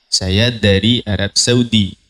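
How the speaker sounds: put-on slow Arabic accent.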